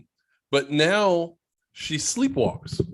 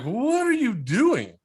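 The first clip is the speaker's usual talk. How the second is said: puzzled; 8 semitones higher, slower than usual. Retold or imitated. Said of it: "I'm like".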